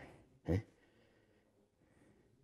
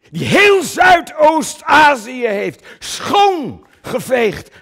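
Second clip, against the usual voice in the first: slow booming praise, much higher and louder; mock-heroic shout; 14 dB louder, much slower than usual. Actor hailing Coen's conquests in a booming mock tribute. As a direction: trumpet his conquests with mock grandeur.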